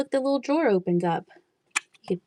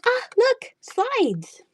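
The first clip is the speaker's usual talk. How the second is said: excited squeal; much slower than usual. Retold or imitated. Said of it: "I was like".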